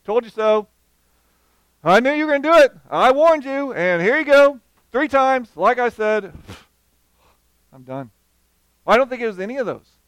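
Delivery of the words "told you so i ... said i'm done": exaggerated, louder and higher smug i-told-you-so; eye-rolling told-you-so. Jesus imagined scolding Peter for denying him, hammed-up smug told-you-so. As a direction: ham up an eye-rolling teacher who saw it coming. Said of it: "Was it rolling of the eyes, exaggeration?".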